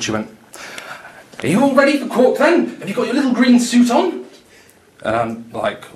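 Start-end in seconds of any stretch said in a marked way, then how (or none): 1.4-4.3 high, louder jokey voice; high playful ribbing; teasing co-worker. Retold or imitated. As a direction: tease him about his trip. Mimicking co-worker June.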